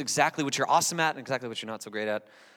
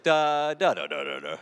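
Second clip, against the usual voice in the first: put-on prayer voice. Preacher mimics the sound of showy prayer, mock pompous. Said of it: "when you do that with your voice".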